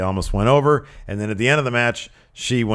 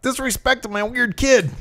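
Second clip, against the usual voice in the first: high-pitched mock indignation; whiny wrestler; 13 semitones higher than usual. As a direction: protest in a high, silly put-on voice. Mocking the wrestler's family grievance.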